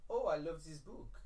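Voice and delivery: a light put-on French accent, slowly; lightly mocked French accent. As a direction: Emma Watson faking a French accent, lightly. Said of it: "me doing an impression of Emma Watson doing an impression of a French accent".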